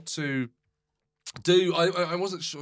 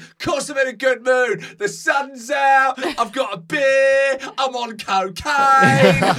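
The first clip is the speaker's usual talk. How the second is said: high, loud, slow and laughing; manic happy shout; 9 semitones higher, 10 dB louder, slower than usual. Retold or imitated. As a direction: ham up a gleeful high guy bragging. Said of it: "He was absolutely mad".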